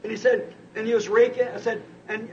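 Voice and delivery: usual speaking voice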